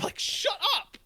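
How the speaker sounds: very high, exasperated squawk; exasperated retort. Acted. Fan rejecting the five v five idea, high exasperated outburst.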